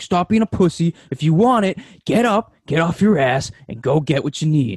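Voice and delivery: like an inner coach yelling you into action; psyching self up. Acted. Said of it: "in my brain, I'm like".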